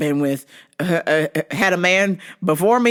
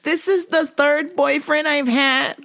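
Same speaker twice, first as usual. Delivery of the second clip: higher, slow, rising to a wail; whiny teen girl voice; 9 semitones higher than usual. Retold or imitated. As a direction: mimic the upset cousin complaining. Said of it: "she's like".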